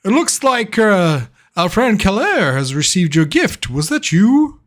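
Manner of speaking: proud father asking his son; Toblin's voice. Acted. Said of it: "He says".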